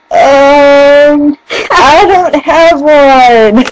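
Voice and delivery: loud, drawn-out mock awkwardness; awkward reply. Retells awkward reply about her accent.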